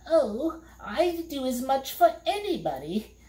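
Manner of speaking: high, put-on fiend voice; a high, smug creature voice; monster voice. A narrator's reading. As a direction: play the sly fiend. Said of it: "he replied".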